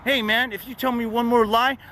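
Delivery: high, fast, bullying cop voice; bossy cop. Retold or imitated. Cops jumping out of the car, high mocking tone. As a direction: play the cops barking orders. Cops ordering him around.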